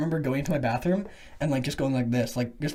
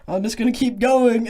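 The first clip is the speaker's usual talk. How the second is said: raised pitch, louder, brushing off help; retold insistence; 6 semitones higher, 5 dB louder than usual. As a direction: imitate a kid insisting to worried helpers. Dazed kid brushing off helpers.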